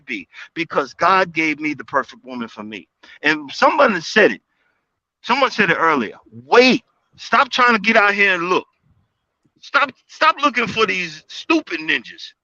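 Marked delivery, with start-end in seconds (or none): none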